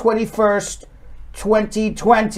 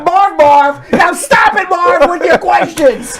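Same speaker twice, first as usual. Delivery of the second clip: higher, laughing New York accent; 7 semitones higher, 3 dB louder, slower than usual. Shown as a demonstration. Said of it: "I have my New York accent".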